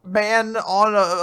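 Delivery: shocked. Retold or imitated. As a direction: act out baffled shock. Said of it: "of course, is very shocked".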